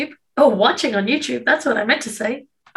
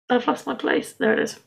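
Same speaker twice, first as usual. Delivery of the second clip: ordinary talk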